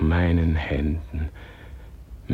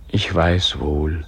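ordinary talk

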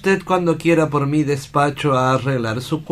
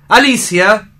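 Alicia called louder and higher, rising; calling out; 5 semitones higher, 4 dB louder than usual. Acted. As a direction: call her name across the house. Llama a Alicia dos veces. (llamando en voz alta)

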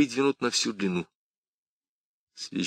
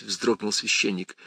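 usual speaking voice

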